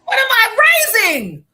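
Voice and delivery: loud, high, half-crying wail of despair; mock-anguished shout about her selfish son; exasperated outcry. Real, not acted. Exasperated outburst over son.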